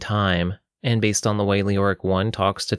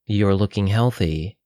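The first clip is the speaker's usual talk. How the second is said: plain reading voice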